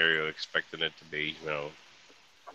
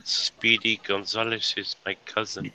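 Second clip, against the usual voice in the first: slow drawling cartoon Mexican accent; 3 semitones higher, slower than usual. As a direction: drag it out like Slowpoke Rodriguez. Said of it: "My name is Slowpoke Rodriguez".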